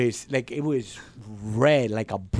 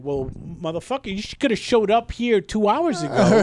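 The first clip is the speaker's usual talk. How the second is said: higher-pitched, exasperated retold rant; retold angry outburst; 6 semitones higher than usual. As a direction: lose your temper while retelling it. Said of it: "that's when I fucking lost it".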